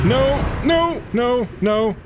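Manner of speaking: high frantic no's; panicked. Real, not acted. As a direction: plead in real panic. Panicked pleading at a monster.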